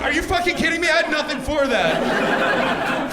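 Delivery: high-pitched comic outburst; exasperated disbelief. Acted. Comic concedes denier's point to audience, exasperated disbelief. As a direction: throw up a helpless, high protest.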